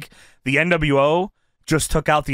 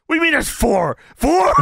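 high, loud, incredulous question; mock bafflement; 10 semitones higher, 3 dB louder than usual. Host playing Eddie baffled by Ray's count, loud incredulity. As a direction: echo it higher, in mock disbelief.

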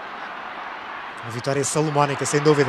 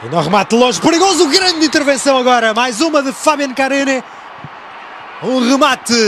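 raised, lively play-by-play; excited commentary; 4 semitones higher than usual. Real, not acted. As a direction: call the chance with rising excitement. Live play-by-play.

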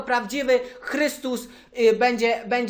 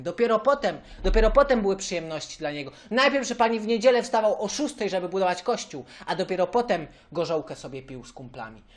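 ordinary delivery

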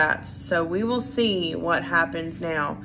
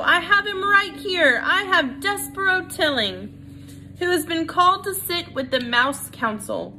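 Furlough announcing; 10 semitones higher than usual. A narrator's reading. Furlough presents Despero to the crowd, high proud call. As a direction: crow it out to the crowd.

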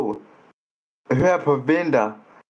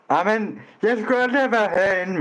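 fast, higher complaint; fast exasperated; 8 semitones higher, faster than usual. Acted. Complaining to friends about being in the chat, fast and higher. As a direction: groan that you never should have come in.

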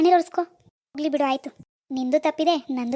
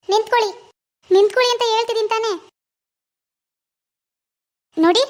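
raised, pleading call to stop; 4 semitones higher, 6 dB louder than usual. Acted. Wife calls after her husband, loud and pleading. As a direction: call after him louder and higher.

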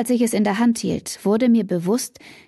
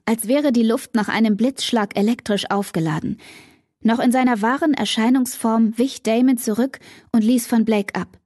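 plain reading voice

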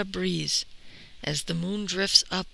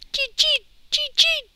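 very high, loud squeaks; piercing pairs of tiny cheeps; squeaking mouse; 11 dB louder than usual. A narrator's reading. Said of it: "Who's that squeaking by the rice barn?".

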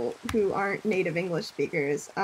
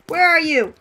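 loud call into the dark; 6 semitones higher, 10 dB louder than usual. Real, not acted. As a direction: shout to find them. Calling out while lost in cave.

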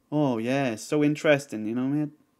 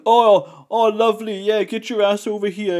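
high, loud mock tough-guy catcall; acting all hard; 6 semitones higher, 6 dB louder than usual. Imitating a streamer acting hard to his viewers. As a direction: strut it out like a streamer playing hard man. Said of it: "they act all hard".

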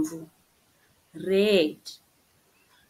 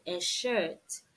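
ordinary talk, nothing marked

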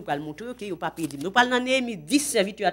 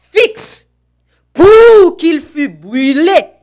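slow preached reading; 5 semitones higher, 7 dB louder, much slower than usual. Acted. Lecture prêchée, très lente.

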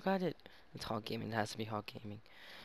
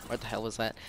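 normal talking voice

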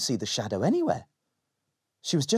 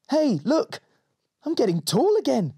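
excited shadow; 12 semitones higher, 3 dB louder than usual. Acted. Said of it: "said the shadow".

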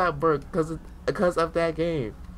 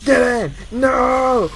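louder urgent commands; frustrated shout; 6 semitones higher, 8 dB louder, much slower than usual. Real, not acted. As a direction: bark it at the game. Frantic at game.

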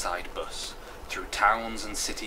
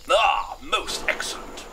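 high, slow, loud relish; drawn-out jolly colonel voice; pleased colonel; 9 semitones higher, 6 dB louder, slower than usual. Acted. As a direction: savour it like a colonel.

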